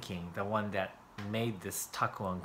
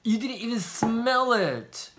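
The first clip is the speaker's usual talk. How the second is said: indignant shriek; 11 semitones higher, 6 dB louder than usual. Real, not acted. Teasing partner who skipped the sniff.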